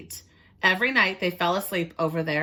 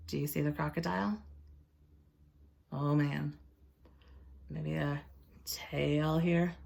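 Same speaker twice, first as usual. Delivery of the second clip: hushed, wary storyteller voice; 3 semitones lower, 9 dB quieter than usual. Acted. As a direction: whisper it like the crocodile might hear. Teacher hushed, pointing at crocodile.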